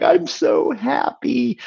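high and slow, overcome; host retells a friend's joy, high and slow; tearful joy quote. Retold or imitated. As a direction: stretch it out high like tears of joy. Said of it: "Chip was like".

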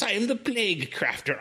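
high hammy villain voice; cartoon villain. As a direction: declare it like a cartoon baddie. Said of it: "cartoon villain name".

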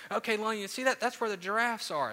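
put-on high, bright playful dad voice to a toddler; dad showing his daughter the monkeys, playfully excited; playful zoo guide. Pretend zoo tour.

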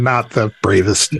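ordinary talk, nothing marked

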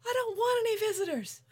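very high-pitched, hammed-up outburst; mock yelling. Co-host acting out his outburst, mock yelling. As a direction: imitate an overwrought yell, stretched and far above your own pitch. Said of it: "And he yells out".

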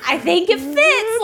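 high, slow forced conviction; straining to sound convinced; strained pretense. Retold or imitated. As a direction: pretend the tight coat fits. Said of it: "I was trying so hard".

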